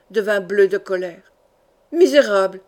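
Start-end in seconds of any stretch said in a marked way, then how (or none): 1.9-2.6 a loud roared insult; roaring. A narrator's reading. Count roaring at the insolent porter, loud furious shout. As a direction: bellow the word out in rage. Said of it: "rugit Olaf de Saville".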